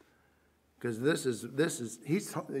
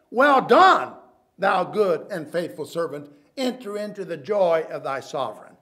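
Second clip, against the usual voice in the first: proclaiming praise; 10 dB louder than usual. Acted. Voicing the master's praise.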